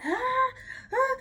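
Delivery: high mock cry of labour pain. New mum recalls contractions, crying out high in mock pain. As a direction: replay the contraction with a sharp high wail. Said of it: "I'm like".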